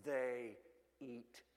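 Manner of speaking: put-on low, grave father to a little girl; father kneels to tell his daughter gravely; serious fatherly voice. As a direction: say it dead serious, like a dad. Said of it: "in a very serious, very unwise, fatherly voice".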